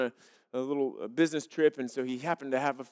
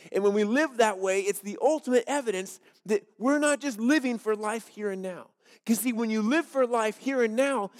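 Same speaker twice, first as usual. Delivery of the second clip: louder, driving preacher voice; forceful preaching; 6 semitones higher, 6 dB louder than usual. Acted. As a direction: press each line home to the congregation.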